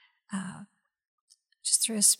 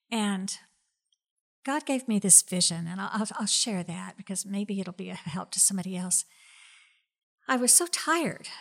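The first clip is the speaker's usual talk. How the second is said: usual speaking voice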